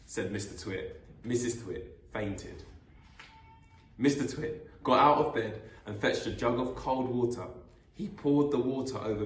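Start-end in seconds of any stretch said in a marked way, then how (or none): none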